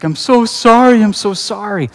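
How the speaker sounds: retold tearful apology, pitched high; mock-bawl it, high and loud at first, then easing; imitated bawling. Nephew apologising to his uncle after breaking something, imitated bawling. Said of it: "he was bawling".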